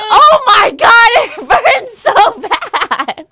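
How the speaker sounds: slow, high, loud wail through laughter; loud laughing cry of pain. Retold or imitated. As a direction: wail it out, half sobbing, half laughing. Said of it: "and I was crying".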